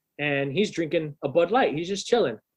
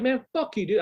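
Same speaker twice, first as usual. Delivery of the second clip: pitched-up angry retort; teen squaring up to a bouncer, pitched up; retold anger; 9 semitones higher than usual. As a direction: spit it at the guard, ready to fight. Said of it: "I'm about to fight this dude".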